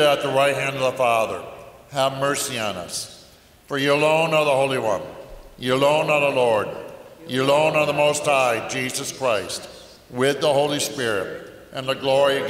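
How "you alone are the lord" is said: ordinary delivery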